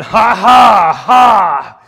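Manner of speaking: loud jeering ha ha ha from the crowd; mocking laughter. Passersby jeering at Jesus, loud mocking laugh. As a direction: bellow a scornful laugh at the crucified man. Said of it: "were hurling abuse at him".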